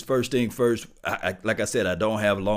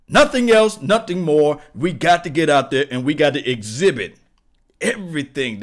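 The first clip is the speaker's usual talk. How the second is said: hyped shout; 6 semitones higher, 9 dB louder than usual. Real, not acted. Hype rant after the deep voice.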